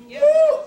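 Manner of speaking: high, loud "woo!"; sudden pulpit whoop; exultant whoop. Real, not acted. Preacher exulting mid-sermon.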